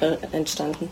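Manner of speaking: usual speaking voice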